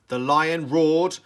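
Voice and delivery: slow, loud dramatic narration. A narrator's reading. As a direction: boom out the roar. Narration of the battle.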